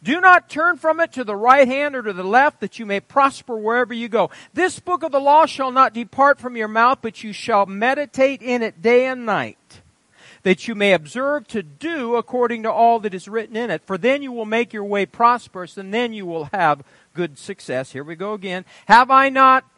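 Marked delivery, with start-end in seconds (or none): 0.0-17.9 raised, ringing Bible reading; scripture reading. Acted. Preacher reading Joshua to the congregation, ringing proclamation. 18.9-19.6 sudden louder ringing declaration; loud proclaiming. Acted. Preacher proclaiming God's question, loud and ringing.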